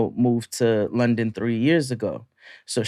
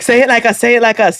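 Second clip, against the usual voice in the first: eager coaxing; 9 semitones higher, 6 dB louder, faster than usual. Retold or imitated. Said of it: "And they were like".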